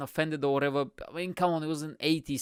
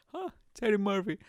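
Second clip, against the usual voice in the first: lightly attempted comedian impression, high; Eddie Murphy; 6 semitones higher, faster than usual. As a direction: try a higher-pitched celebrity voice. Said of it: "I wish I was good at impressions".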